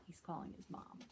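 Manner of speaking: whispered aside. A narrator's reading. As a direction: breathe it softly. Storyteller confides quietly.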